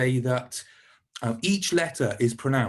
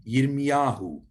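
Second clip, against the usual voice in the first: "Yirmiyahu" said yir-mi-YA-hu; penultimate stress; slower than usual. Shown as a demonstration. Teacher modelling Jeremiah's name, "Yirmiyahu" stressed before the last syllable. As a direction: say "Yirmiyahu" with weight on ya. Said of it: "changes to the one before the last syllable".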